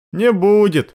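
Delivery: high, falling, mildly resigned echo; resigned sigh. A narrator's reading. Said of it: "вздохнул Рон".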